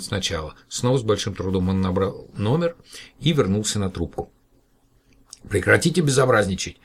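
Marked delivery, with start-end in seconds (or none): 5.5-6.8 louder angry threat; shouting. Acted. Professor threatens to call the police, shouting. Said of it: "закричал профессор".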